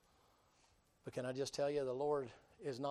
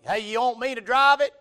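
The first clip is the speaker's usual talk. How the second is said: much higher, loud eager offer; 10 semitones higher, 19 dB louder than usual. Retold or imitated. Stuck woman offering to drive, loud and overeager. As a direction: jump in, way too eager to help. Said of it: "Here comes Bubby".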